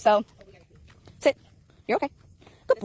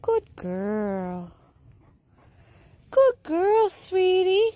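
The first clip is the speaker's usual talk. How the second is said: high-pitched praise; 5 semitones higher, much slower than usual. Real, not acted.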